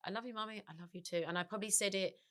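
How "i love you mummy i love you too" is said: murmured almost silently, like a lullaby; whispered repetition. Retold or imitated. Mother whispering her son's words before sleep, hushed. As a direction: repeat it softly to yourself. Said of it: "So I repeated him going".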